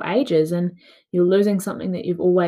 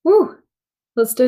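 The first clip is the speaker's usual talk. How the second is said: excited cheer; 5 semitones higher, 6 dB louder than usual. Real, not acted. Cheering now that voice is back.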